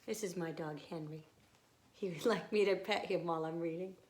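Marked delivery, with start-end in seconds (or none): none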